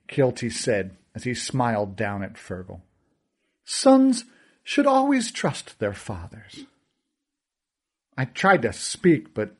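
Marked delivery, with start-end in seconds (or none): 3.6-6.6 much higher, slow, silky menace; smug villain. Acted. Kealty lectures about trusting fathers, slow silky smugness. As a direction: purr the lesson with a smile.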